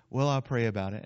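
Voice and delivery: low, mild noncommittal reply; polite brush-off. Acted. Churchgoer answering pastor's request, low polite brush-off. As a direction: dodge the request mildly. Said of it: "the polite way of telling your pastor".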